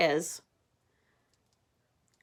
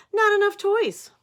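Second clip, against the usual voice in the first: very high, loud whiny complaint; whiny pigeon; 8 dB louder than usual. Acted. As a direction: wail it like a fussy kid. Pigeon complaining about bath.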